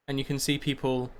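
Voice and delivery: normal talking voice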